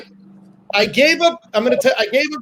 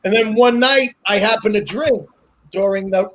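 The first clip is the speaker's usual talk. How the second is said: ordinary delivery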